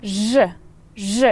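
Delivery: Ж said on its own with weight; stressed particle ж. Shown as a demonstration. Teacher showing where the emphasis falls on Ж. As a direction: lean on the particle Ж. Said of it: "You put emphasis on it".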